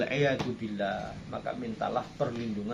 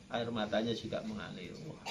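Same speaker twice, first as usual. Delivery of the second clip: ordinary talk, nothing marked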